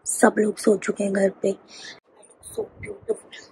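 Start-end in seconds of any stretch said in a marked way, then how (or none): 1.5-3.4 slow hushed whisper; whispered self-praise; soft whisper. Real, not acted. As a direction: breathe it out quietly, admiring. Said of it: "I'm speaking very, very slow".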